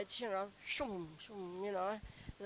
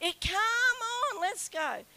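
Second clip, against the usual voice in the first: urging; 10 semitones higher, 4 dB louder, slower than usual. Retold or imitated. Kevin urging, retold.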